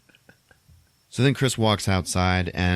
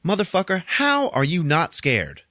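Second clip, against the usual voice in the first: incredulous alarm; 9 semitones higher than usual. Retold or imitated. Friend alarmed that he isn't scared, incredulous. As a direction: act out an alarmed friend, stretching the words.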